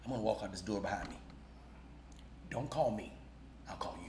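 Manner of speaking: low, quiet and cool; low soft deadpan; cool exit line. Retold or imitated. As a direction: brush them off coolly. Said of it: "I said".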